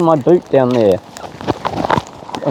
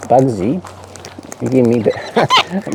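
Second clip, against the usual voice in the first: ordinary delivery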